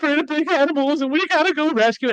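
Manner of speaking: high, loud hammed-up wail; mock crying wife. Husband imitates pregnant wife pleading for the cat, mock tearful wail. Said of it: "she'd just be crying".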